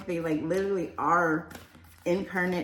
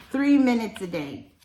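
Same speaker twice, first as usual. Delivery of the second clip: raised voice, higher and louder; yelling; 5 semitones higher, 5 dB louder than usual. Real, not acted. Reader urging viewers to make a small daily effort, yelling. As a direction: yell it out as an emphatic instruction. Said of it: "I don't know why I'm yelling".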